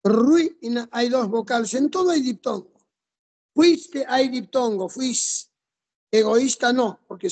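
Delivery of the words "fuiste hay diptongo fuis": ordinary talk, nothing marked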